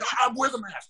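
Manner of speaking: high-pitched stammered indignation; imitating real offended. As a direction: mimic a man who is affronted and sputtering. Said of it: "real offended".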